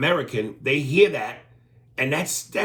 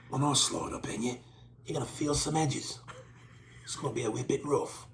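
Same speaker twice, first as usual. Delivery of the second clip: softer mock bedroom voice; mock seductive tough guy; 8 dB quieter than usual. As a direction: purr it like a hard man in bed. Acting Statham seducing.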